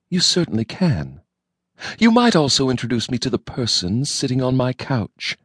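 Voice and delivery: lightly voiced cold, stern wife; polite but freezing, a hint of threat; icy wife. A narrator's reading. Wife coldly demanding an introduction, lightly icy.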